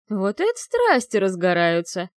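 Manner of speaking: lightly voiced creaky old ghost; a hint of a creaky old mage; creaky archmage. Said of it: "скрипучий голос архимага Клирола".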